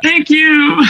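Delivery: very high, far-off squeal of joy; ecstatic scream. Retold or imitated. Actor screaming at home after booking his first role. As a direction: shriek it like you just got the part.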